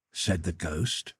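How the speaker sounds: plain reading voice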